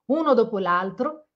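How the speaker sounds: ordinary delivery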